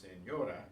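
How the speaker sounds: "señora" said as sen-YO-ra; "señora" with the next-to-last syllable strongest; stress on middle syllable. Shown as a demonstration. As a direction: lean on the ÑO of "señora". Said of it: "That's the next to the last syllable".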